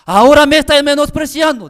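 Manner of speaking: high, loud furious accusation; furious pastor. Retold or imitated. Pastor furiously accusing the seminarian, high and loud. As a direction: explode at the student. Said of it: "el pastor se enojó en gran manera".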